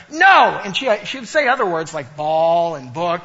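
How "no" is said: put-on shouted toddler no, high; toddler's no. Dad imitating his daughter shouting no. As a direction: belt out a two-year-old's defiant no.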